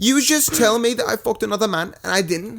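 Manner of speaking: high mock-indignant British girl. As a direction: imitate a girl protesting in a British accent. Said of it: "or like the girl will be".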